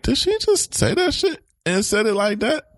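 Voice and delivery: high, startled inner voice; shocked inner thought. Retold or imitated. Recalling her own reaction to a friend's blunt remark, high and startled. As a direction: gasp the question up high in disbelief. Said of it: "I'll be like".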